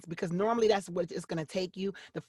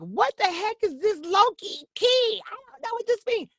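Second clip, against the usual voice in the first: high-pitched, hammed-up confusion; exaggerated bewildered wail, retold; mock bafflement; 5 dB louder than usual. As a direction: act out a stumped beginner, pitched way up. Voicing a baffled client's reaction.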